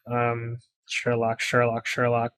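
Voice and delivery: low, slow, soft detective voice; Sherlock voice tryout. Shown as a demonstration. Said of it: "I need a voice for this guy".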